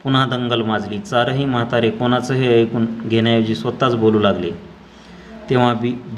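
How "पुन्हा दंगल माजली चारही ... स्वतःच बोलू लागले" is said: ordinary delivery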